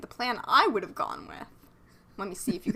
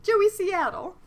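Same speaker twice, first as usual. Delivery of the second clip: higher echo of the name, half crying with laughter; repeating the name; 11 semitones higher, slower than usual. Real, not acted. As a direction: echo Joey Seattle with a laughing whine.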